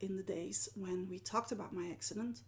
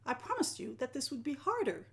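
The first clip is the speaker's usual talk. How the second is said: usual speaking voice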